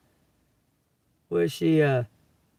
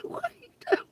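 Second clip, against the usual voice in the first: breathless giggle, barely voiced, the words squeezed out high; almost swallowed by laughter, no voice; laughing whisper; 5 dB quieter, much faster than usual. Real, not acted. Laughing at the people.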